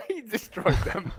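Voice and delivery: high, loud, slow delighted exclamation; excited, laughing. Real, not acted. Player hyping a teammate who went ham, excited and laughing. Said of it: "Hear the excitement in your voice".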